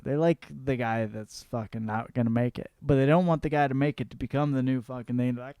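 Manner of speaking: usual speaking voice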